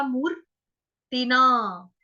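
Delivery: मूर्तिना said slowly with a long मू and र. Shown as a demonstration. Teacher repeats the word slowly for students. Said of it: "रफार वाला र".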